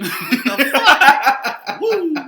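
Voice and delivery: high, loud, laughing disbelief; laughing shout. Real, not acted. Laughing shock.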